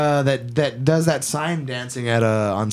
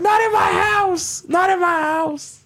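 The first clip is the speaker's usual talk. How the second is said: much higher, slow shouted protest; imitated shout. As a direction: yell it like you're guarding your home. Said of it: "he's like".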